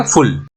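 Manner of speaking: फुल with the f landing quickly on the l; short vowel. Shown as a demonstration. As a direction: rush through the u in फुल to the l. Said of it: "तुरंत एल पे पहुंच रहे हैं". (फुल में छोटा उ, तुरंत ल)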